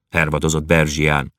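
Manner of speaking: usual speaking voice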